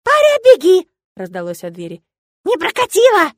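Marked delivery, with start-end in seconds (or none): 0.0-0.9 high, loud shout of alarm; urgent high-pitched yell; yelled warning. Acted. Friend yells warning from door. 2.4-3.3 high, loud yell; loud, high-pitched exclamation; called-out shout. Acted. Friend shouts from the door.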